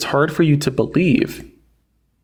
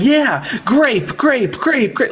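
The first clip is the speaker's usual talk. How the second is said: mock cheer; 10 semitones higher, much slower than usual. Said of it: "And you were like".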